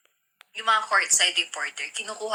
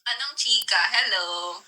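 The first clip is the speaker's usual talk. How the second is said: ordinary talk, nothing marked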